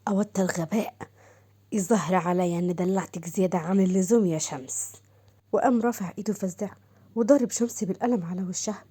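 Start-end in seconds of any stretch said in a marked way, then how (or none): 0.1-4.9 low, cold voice of a furious husband; angry husband. Acted. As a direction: scold her from low in the chest, hard. Angry husband scolds wife Shams. (صوت زوج غاضب بارد ومنخفض)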